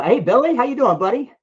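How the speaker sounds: ordinary talk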